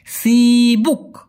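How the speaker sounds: drawn-out, very slow sibuk; slow demo. Shown as a demonstration. Teacher slowly models সিবুক for learners. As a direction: stretch সিবুক out syllable by syllable. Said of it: "কি বলা হয়?".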